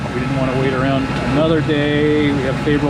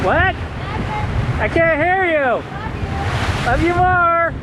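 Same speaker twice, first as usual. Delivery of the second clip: raised voice calling across the deck; calling out over noise; 13 semitones higher than usual. Real, not acted. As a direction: call out over the wind. Calling across the boat, can't hear.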